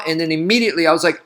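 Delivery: normal talking voice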